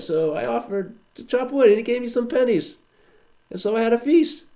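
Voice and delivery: chatty high fixer explaining his feast; cheerful fixer. Acted.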